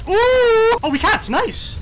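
loud high whoop easing into cheer; whoop, then celebrate; delighted surprise. Real, not acted. Gamer seeing the team capped, delighted whoop.

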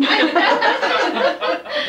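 high, bright laugh breaking out; burst of laughter. Real, not acted. Reader laughs at own story.

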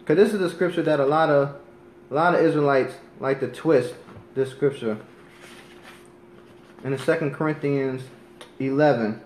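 ordinary talk, nothing marked

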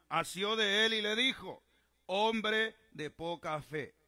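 loud, declamatory Bible reading; preacher's ringing scripture recitation. Real, not acted. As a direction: proclaim the passage to a congregation. Reads scripture to congregation.

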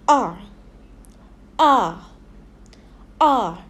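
R said as a long open "ah", falling; modelling the sound. Shown as a demonstration. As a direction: sound out R alone as "ah". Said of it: "learning a new sound".

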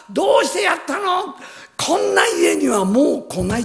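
high, loud, heated son with half-laughs; son's angry outburst. Retold or imitated. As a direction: rant at father, rising to a shout. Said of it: "ってこう言った".

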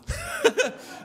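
high, loud laugh; burst of laughter. Real, not acted. Comedian laughs loudly at his own life-jacket joke. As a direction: laugh at the punchline.

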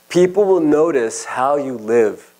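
slow and deliberate; slow emphasis. Real, not acted. Preacher driving home the lesson, slow and deliberate. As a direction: lean on each word slowly.

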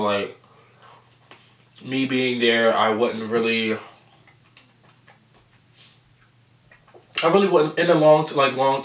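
chewing between words; talking around a bite; mouth full of food. Real, not acted. Seen 1: Takes forkful at 1.9-2.2, then speaks with food in mouth.

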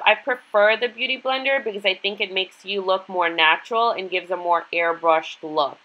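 usual speaking voice

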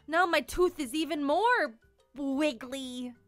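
ordinary delivery, nothing marked

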